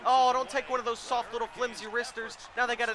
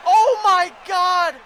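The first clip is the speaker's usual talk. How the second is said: high, loud shouts of dismay; alarmed shouting; 8 semitones higher, 11 dB louder than usual. Real, not acted. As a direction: yell in real alarm at the play. Dismay at live game action.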